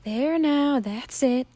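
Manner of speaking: gentle comforting coo; soothing. Acted. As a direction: Willie soothing the crying girl. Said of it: "Willie soothed".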